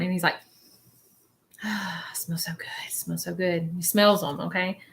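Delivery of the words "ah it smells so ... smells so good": breathy whisper of delight; whispered savoring. Retold or imitated. Medium relays spirit savoring a smell in a whisper.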